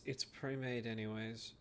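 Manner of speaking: low, soft grumbled aside; under her breath. Acted. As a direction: say it quietly to yourself. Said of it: "Under her breath".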